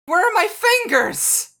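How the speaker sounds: high, loud, slow panic; panicked shriek. Acted. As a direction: shriek it in alarm. Panics at having hooves.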